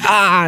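high "ah" of pretend pain; mock pain cry. Imitating someone who just stubbed their toe, mock pained yelp.

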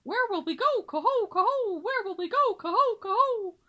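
put-on high, loud singsong of young swans; crying cygnets. Cygnets crying out to their father, high singsong. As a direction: chant it like excited baby swans. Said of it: "cried all the cygnets".

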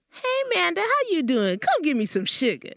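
put-on high, loud old church lady calling out; church lady greeting. Older church women greeting a girl, high and sweet. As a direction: greet her like a beaming older church woman. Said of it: "some of the older women would be like".

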